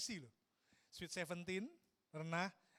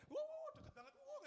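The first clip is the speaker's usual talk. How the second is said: very high, softer hammed-up cooing; mock close chat; 7 dB quieter than usual. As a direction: caricature a couple talking far too close. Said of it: "Kan tidak mungkin juga ngobrolnya".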